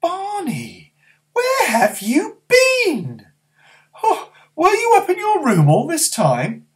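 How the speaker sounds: put-on high, slow fretting old woman; granny anxious about her late grandson; worried granny. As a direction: fuss over him like an anxious granny.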